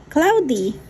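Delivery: ordinary talk, nothing marked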